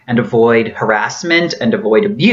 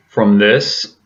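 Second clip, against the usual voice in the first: former voice; 3 semitones lower than usual. Shown as a demonstration. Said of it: "to this".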